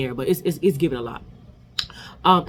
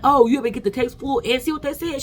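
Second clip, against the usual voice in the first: mocking; 7 semitones higher than usual. Kelly mocking Phaedra in confessional.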